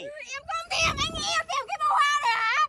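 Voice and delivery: high, loud and upset; indignant outburst. Acted. Woman rejects her ex's bouquet, indignant shouting. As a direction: raise the voice in hurt indignation.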